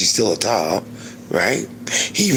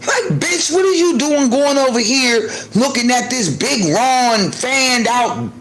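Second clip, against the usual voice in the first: scolding myself; 7 semitones higher than usual. Retold or imitated. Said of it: "I just had to check myself".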